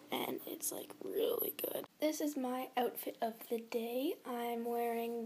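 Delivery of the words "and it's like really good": whisper. Real, not acted. Whispered while eating.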